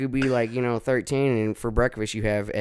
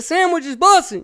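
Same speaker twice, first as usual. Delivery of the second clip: much higher, louder sandwich is bussin'; exaggerated slang quote; 7 dB louder than usual. Host hamming up his slang line to Chicago dudes. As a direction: squeal the slang in a silly voice. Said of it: "you go and I said".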